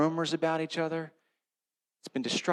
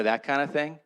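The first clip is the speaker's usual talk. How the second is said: usual speaking voice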